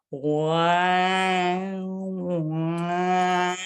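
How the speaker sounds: lips stretched over the teeth. Shown as a demonstration. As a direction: wow with lips covering teeth. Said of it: "it sounds like a cat a little bit".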